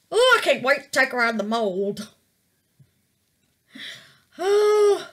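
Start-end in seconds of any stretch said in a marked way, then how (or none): 4.4-5.1 excited gasp. Real, not acted.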